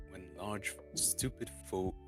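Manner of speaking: ordinary delivery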